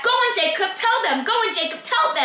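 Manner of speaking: high, insistent urging; goading. Retold or imitated. The girl pushing Jacob to confess, goading.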